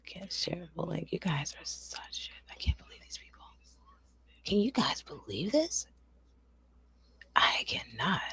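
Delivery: whispered grumbling to chat; bitter whisper. Real, not acted. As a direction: hiss the complaints under your breath. Upset streamer whispering complaints.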